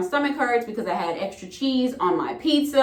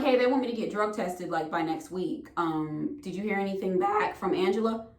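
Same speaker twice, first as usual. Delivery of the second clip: lower, softer careful asking; polite text message; 5 semitones lower, 7 dB quieter than usual. Retold or imitated. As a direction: ask gently, almost tiptoeing. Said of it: "so I was telling him".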